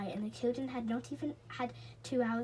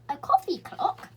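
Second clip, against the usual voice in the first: puzzled high squeak of surprise; surprised; 7 semitones higher, 9 dB louder than usual. A narrator's reading. Saucepan man to Moonface, puzzled and surprised. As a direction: sputter it out, baffled. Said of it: "even more surprised".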